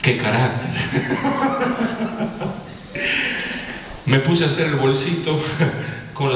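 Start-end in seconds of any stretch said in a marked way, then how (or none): 0.8-4.0 high, light chuckling; long run of amused chuckles; laughing. Real, not acted. Speaker amused by his own bad-tempered outburst, laughing.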